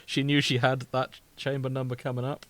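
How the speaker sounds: ordinary delivery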